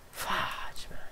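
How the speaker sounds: breathy whisper, almost no voice; whispered curse. Real, not acted. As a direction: whisper it to yourself, deflated. Muttering after the scare.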